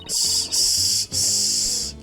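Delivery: unvoiced snake hiss. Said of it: "doing a snake voice".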